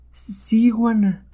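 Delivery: high-pitched, obedient reply; submissive witch. Acted. Hypnotized witch answers the hero, submissive.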